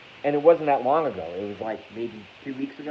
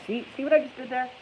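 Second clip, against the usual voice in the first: much higher, put-on catchphrase voice; Blackie Fitzpatrick catchphrase; 13 semitones higher, faster than usual. Streamer imitates another YouTuber's catchphrase in a high put-on voice. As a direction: squeak it out as a YouTuber's signature line. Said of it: "I'm becoming Blackie Fitzpatrick".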